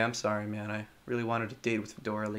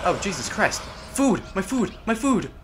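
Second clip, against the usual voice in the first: loud, high cry of joy at food; excited food shout; 6 semitones higher, 7 dB louder than usual. Real, not acted. Gamer spots food and shouts with glee. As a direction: yell out for the food, thrilled.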